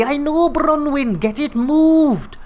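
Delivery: put-on Cornish accent, insistent. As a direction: Cornish accent, pushing Bronwyn to move it. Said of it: "Yeah, great Cornish accent".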